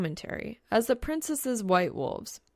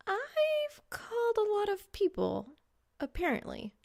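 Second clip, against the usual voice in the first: put-on bewildered girl; 14 semitones higher than usual. Reaper confused, very high.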